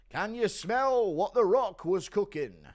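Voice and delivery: loud, high, drawn-out catchphrase; Bully Tom. Acted. Streamer impersonating someone after a fart, loud slow catchphrase. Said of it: "Impression of Bully Tom".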